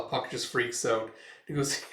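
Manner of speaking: usual speaking voice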